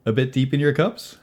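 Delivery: high, loud needling question; sarcastic. Acted. Walter Forbes teases Yoros about drinking, high-pitched sarcasm. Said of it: "sarcastic as always".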